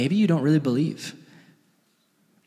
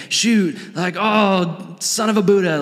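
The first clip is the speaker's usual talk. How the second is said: higher mock cursing; mock swearing; 5 semitones higher, slower than usual. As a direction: imitate a tame substitute curse.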